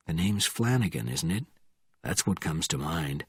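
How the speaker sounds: low, soft, controlled needling; cool, quiet. A narrator's reading. Bourne naming the panicking sergeant, cool and quiet.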